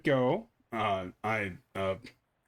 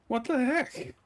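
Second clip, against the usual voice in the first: surprised; 11 semitones higher than usual. Real, not acted. Trunk burns.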